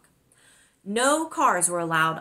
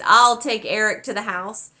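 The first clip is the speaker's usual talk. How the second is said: cheery boom; 6 semitones higher, 6 dB louder than usual. Acted. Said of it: "he boomed in a cheery voice".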